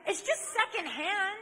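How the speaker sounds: high, loud mock plea; mock pleading. Young man pressing a girl to accept the ring, mock pleading. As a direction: push the excuse up high and loud.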